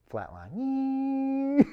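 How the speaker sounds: word trailing into a high, held monitor beep; flatline imitation. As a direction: mimic a heart monitor going flat.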